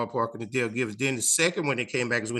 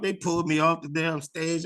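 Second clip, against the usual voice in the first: ordinary delivery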